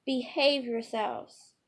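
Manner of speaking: loud, slow, stern shout; scolding scream. Acted. Mother shouts at her fighting boys, loud and slow. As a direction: yell at the kids to stop, slowly and loudly. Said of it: "Lucinda screams".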